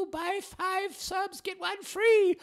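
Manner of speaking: squeaky, hammed-up promo guy handing out cards; mock sandwich-shop promoter. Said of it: "the guy that works at the Quiznos handing out those little cards".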